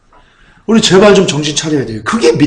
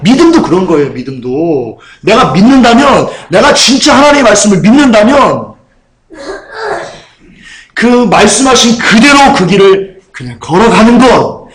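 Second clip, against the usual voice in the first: full-force preaching; 4 semitones higher than usual. Real, not acted. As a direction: drive the point home from the pulpit. (높고 크게 힘주어 설교하듯)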